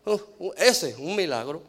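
doubtful dismissal. Retold or imitated. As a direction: imitate writing someone off as needing "un milagro". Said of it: "ha dicho así de alguien".

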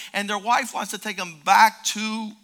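ordinary talk

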